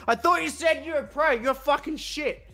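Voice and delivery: high, loud jeering heckler; mock taunting. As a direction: jeer like an outraged gamer. Gamers taunting him, acted out.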